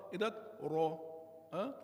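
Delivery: ordinary delivery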